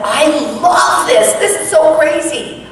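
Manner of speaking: high, delighted gush; eager fan gushing; excited. Retold or imitated. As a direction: gush with glee. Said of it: "I said".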